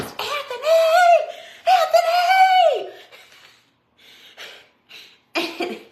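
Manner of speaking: hammed-up shout for Anthony; yelling for husband. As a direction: call him from the bathroom at full volume. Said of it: "I yell what I yell best".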